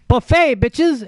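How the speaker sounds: high, loud mock feast call; gleeful announcement. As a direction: crow it like hungry spiders finding a feast.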